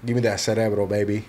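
usual speaking voice

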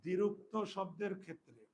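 slow, word by word for pupils to write; teacher dictating a rule, slow and spaced; slow dictation. Shown as a demonstration. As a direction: dictate it slowly with pauses.

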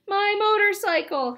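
high, loud wail of dismay; despair. A narrator's reading. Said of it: "he thought in despair".

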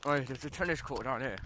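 usual speaking voice

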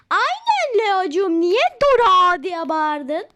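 loud, slow exaggerated telling-off; loud slow mock scolding. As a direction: drag out the reproach loudly. Mother scolds child.

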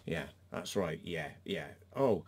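normal talking voice

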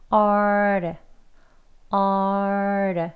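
얼 as long drawn-out ar-duh; R into flap. Shown as a demonstration. Teacher repeats for practice, 얼 a slow ar with flap. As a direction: hold the R in 얼, then tap straight into a flap. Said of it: "이어서 르 하고 치경을 바로 치셔야".

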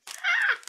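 very high loud delighted squeal; squeal of joy. Real, not acted. Thrilled by a friend's personalized gift, high delighted squeal. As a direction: let out a shrieking squeal of joy.

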